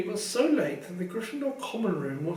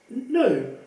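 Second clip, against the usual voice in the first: frantic; 3 semitones higher, 5 dB louder than usual. Acted. Harry hearing a voice no one else hears, frantic. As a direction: blurt it out in a fright. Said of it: "said Harry frantically".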